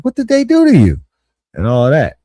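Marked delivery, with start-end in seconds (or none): 0.0-1.0 high, loud, shocked mother; alarmed mom. Retold or imitated. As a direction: cry out like a mom seeing her hurt kid. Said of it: "And she like".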